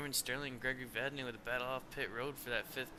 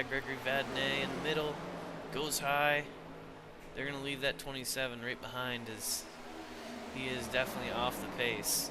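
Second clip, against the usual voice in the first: louder, faster race call; live play-by-play; 5 dB louder than usual. Acted. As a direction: call the restart with rising excitement.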